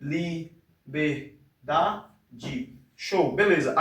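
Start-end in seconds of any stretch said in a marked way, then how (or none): 0.0-1.3 "Liberdade" very slow and softer, broken into separate syllables. Shown as a demonstration. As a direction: sound it out beat by beat. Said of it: "leia essas palavras aí pausadamente".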